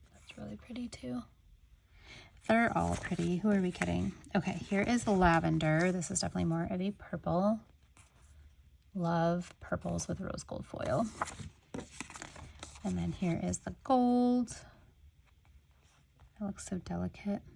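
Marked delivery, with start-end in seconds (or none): 0.1-1.2 very soft, whispered aside; admiring whisper. Real, not acted. Admiring a foiled swatch for viewers, whispered. 16.4-17.4 hushed, barely voiced aside; admiring whisper. Real, not acted. Admiring delicate gold foil on lavender, whispered.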